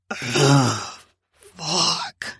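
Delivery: low growled groan from the man. Acted. Kevin answering Candy with a low growled groan. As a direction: let it out as a low male growl. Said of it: "a delicious man growl".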